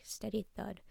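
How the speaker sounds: ordinary delivery, nothing marked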